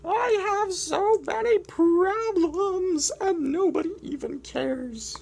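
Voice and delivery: whiny penguin, high loud wails to mom and dad; lost chick calling out, then sulking; complaining penguin. Acted. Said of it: "Doing my complaining voice hurts".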